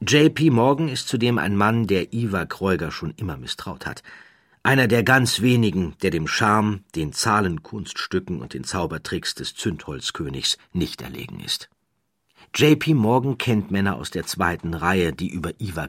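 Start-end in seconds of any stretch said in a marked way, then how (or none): none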